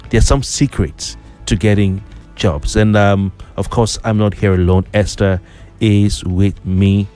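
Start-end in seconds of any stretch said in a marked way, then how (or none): none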